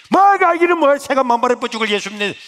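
loud, high angry shout; shouting down a little brother's faith; brother cursing. Retold or imitated. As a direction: bark it back like an angry older brother. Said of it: "막 욕을 하는 거예요".

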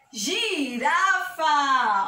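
"Girafa" stretched very long by syllable; slow syllable demo. Shown as a demonstration. Teacher giving children a gi word, very drawn out. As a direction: sound out "Girafa" very slowly.

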